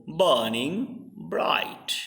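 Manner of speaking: "burning bright" slow, BURN and BRIGHT hit, "ing" light; scanned verse. Shown as a demonstration. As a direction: read "burning bright" slowly, beat by beat. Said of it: "in burning you find just burn".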